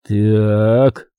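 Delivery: one long, drawn-out word; slow. A narrator's reading. Listener ponders her full name, speaking slowly. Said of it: "медленно проговорил я".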